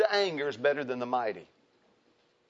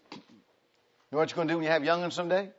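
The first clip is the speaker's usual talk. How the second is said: ordinary delivery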